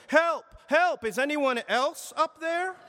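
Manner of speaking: high, loud desperate cries for help; yelling for help. A narrator's reading. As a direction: shout up the cliff in panic. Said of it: "Jack yelled".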